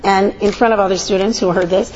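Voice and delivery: usual speaking voice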